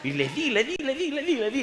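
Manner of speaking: high and loud, laughing through it; building glee at the retelling; excited. Real, not acted. Preacher telling the church how he scolded, excited.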